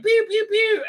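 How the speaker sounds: very high mock pew pew blaster noises; childish ray-gun sound effects; imitated laser blasts. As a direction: make silly toy-gun sounds. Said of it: "they try and emulate aliens".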